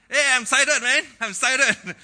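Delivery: loud, high-pitched burst of excitement; excited. Acted. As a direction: act out being hugely excited, shouting it. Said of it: "Or you get excited".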